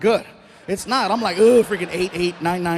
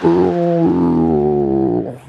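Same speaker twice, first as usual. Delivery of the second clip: monster roar; 14 semitones lower, 7 dB louder than usual. Retold or imitated. Dude enters, Pacific Rim.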